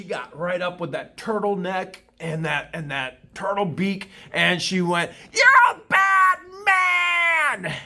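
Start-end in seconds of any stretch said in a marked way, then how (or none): 5.3-7.7 drawn-out mock shriek, much higher and louder, very slow; hammed-up hysterical scream; imitating a screaming woman. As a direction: wail it out like an outraged accusation. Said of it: "And screaming and yelling".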